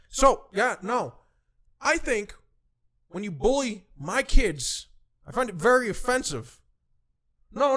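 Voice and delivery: measured pauses, then forceful fighting talk; mock confrontational boss. Boss challenging the bully to come down here, confrontational.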